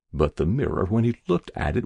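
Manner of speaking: plain reading voice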